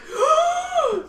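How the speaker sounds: high sharp gasp; excited gasp. Retold or imitated. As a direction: gasp in delight. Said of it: "I was like".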